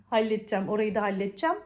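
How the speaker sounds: ordinary delivery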